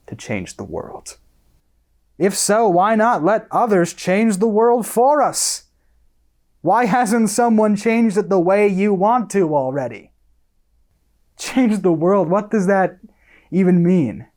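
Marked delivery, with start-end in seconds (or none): 2.2-10.1 raised, high-pitched exasperation; heated rhetorical questioning; indignant argument. Acted. Acted argument with the friend. 11.4-12.3 higher, indignant outburst; heated, incredulous repetition; exasperated echo. Acted. Exasperated repeat of friend's phrase. 12.3-14.3 high, indignant challenge; heated disbelief; exasperated demand. Acted. Acted argument with the friend.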